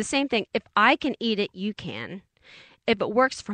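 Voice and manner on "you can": ordinary talk, nothing marked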